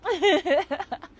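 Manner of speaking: short high, loud giggle; laugh. Real, not acted. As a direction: burst into a high chuckle. Laughs after describing own problem.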